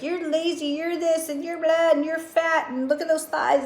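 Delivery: high, sneering inner critic; mock the cruel voice in your head; mean inner critic. To herself, a sneering mean inner voice. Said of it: "to be like".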